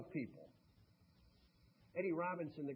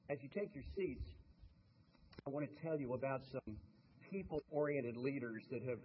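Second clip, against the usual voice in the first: ordinary delivery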